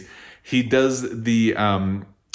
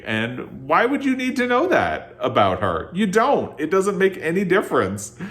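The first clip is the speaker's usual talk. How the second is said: higher-pitched, indignant objection; real exasperation; 9 semitones higher than usual. Real, not acted. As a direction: push the pitch up in disbelief at the pointless detail. Indignant complaint about the book.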